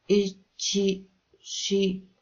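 high, halting broken syllables; boy stuck on the start of a word; struggling to speak. A narrator's reading. Boy fails to get word out.

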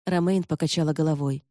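usual speaking voice

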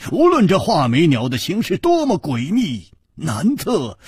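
usual speaking voice

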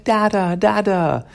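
put-on high, eager child's call; little weasel calling dad. Little weasel runs up to her father, high child's cry. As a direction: call out like a happy little kid. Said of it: "she's like".

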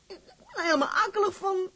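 high, tearful cry; near tears. Acted. Girl begging the sailor, breaking into tears.

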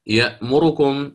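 يأمركم with the ra vowel rushed short; snatched vowel. Shown as a demonstration. Teacher showing how the vowel is rushed. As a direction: read يأمركم and hurry the ra vowel. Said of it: "يأمركم يسرع فيها".

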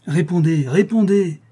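high, loud insistence, climbing on the repeat; utmost agitation. A narrator's reading. Monk pressing the silent orphan to answer, utmost agitation. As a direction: demand an answer urgently. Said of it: "insista Schedoni au comble de l'agitation".

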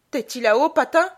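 loud rising question; calling up. A narrator's reading. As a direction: call up to someone through the ceiling. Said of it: "Elle demanda, la tête levée vers le plafond".

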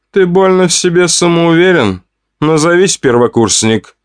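lizard man. A narrator's reading. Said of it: "словно змея прошипел толстяк".

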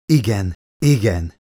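mildly groaned-out agreement; groaned reply. A narrator's reading. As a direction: let it out as an understated groan. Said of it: "nyögte ki Elizabeth".

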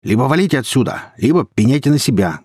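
moderately shouted warning; shouted threat. A narrator's reading. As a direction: warn them off forcefully, voice up. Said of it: "крикнул Гена".

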